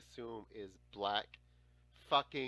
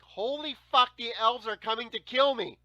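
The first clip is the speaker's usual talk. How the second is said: high, louder mock terror; panicked kid; 10 semitones higher, 12 dB louder than usual. A kid hearing elf footsteps, mock terrified and loud. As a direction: replay the childhood panic. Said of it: "I was like".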